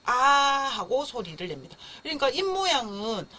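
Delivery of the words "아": long, wide-open "ah" wail; yawn-like baby cry. Shown as a demonstration. Teacher shows the sleepy baby's feature, yawn-like cry. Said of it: "하품할 것처럼 처음부터 입을 크게 벌리더라".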